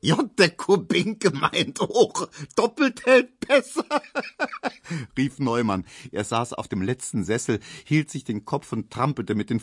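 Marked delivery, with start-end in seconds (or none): 0.0-5.2 high, drawn-out words bursting into laughter; roaring with laughter. A narrator's reading. Neumann joking about Kubinke, helpless with laughter. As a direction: squeeze the joke out while laughing. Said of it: "Neumann lachte".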